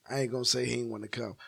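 usual speaking voice

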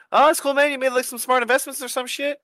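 high-pitched retold response; puzzled mock reply. Replying to a friend's odd text, high puzzled voice.

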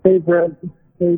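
usual speaking voice

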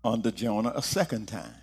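solemn low scripture reading. Acted. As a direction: read the Bible passage solemnly. Scripture reading opening sermon.

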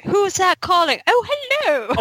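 imitating a cheery, high phone greeting; lightly mock a jolly dad picking up the phone. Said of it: "The jolly tone or whatever".

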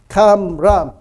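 Kamram pronounced with broad open a vowels, KAHM-rahm; demonstrated pronunciation. Shown as a demonstration. Preacher modelling Kamram for the congregation to repeat, clearly. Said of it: "Đọc theo tôi".

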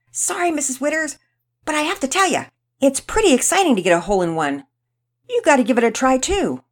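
high, loud excited schoolboy; cheeky boy coaxing. A narrator's reading. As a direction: coax the grown-up into joining the game. Brazen boy coaxes cafeteria worker.

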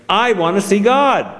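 demanding princess. A narrator's reading. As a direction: insist like a spoiled child. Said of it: "she stamped her foot and said".

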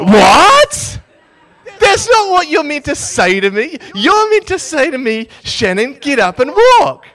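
loud, high-pitched mock outrage; indignant outburst. Storyteller inwardly protesting the prophet's message, indignant shout. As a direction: shout in comic disbelief.

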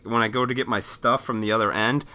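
ordinary talk, nothing marked

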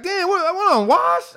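loud, high-pitched disbelief; hammed-up wounded protest; retold indignation. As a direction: squawk it like a dad feeling replaced. Acts out his wounded reaction.

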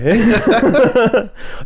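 high, bright laugh that falls away; amused laugh. Real, not acted. Hearing she came from the airport, real laughing. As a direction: laugh out loud at the absurd reply.

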